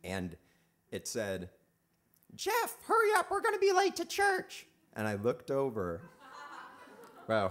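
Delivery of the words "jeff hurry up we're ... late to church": put-on much higher, tiny little girl voice. Little sister calling brother to church, much higher tiny voice. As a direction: call out sweetly like a four-year-old girl. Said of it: "I heard a little voice".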